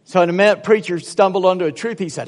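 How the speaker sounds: usual speaking voice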